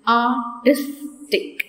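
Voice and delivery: "artistic" drawn out slowly, each syllable apart. Shown as a demonstration. Teacher to students, splitting "artistic" into syllables slowly. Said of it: "three syllables".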